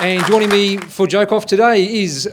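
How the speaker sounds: loud emcee introduction; stage announcer. Real, not acted. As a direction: bring the act on with a loud announcement. Host introduces comedian onto stage.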